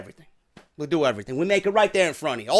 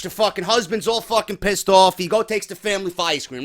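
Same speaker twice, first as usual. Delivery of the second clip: ordinary talk, nothing marked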